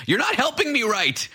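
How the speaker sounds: very high mock screech; imitated screaming. Host mimics old lady scolding her helpers, shrieky mock scream. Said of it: "she's just screaming at us".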